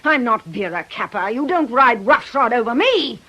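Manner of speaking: loud, rising fury at a man who threatened her; a woman refusing to be bullied; furious. Acted. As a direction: hurl it back at him, furious and loud. Aggie furious at Tom.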